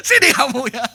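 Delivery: loud, high mock summons to a pupil; mock threat. Teacher threatening a cheeky pupil, playfully angry. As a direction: call the child over as if for a smack. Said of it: "kalau saya pok gitu ya".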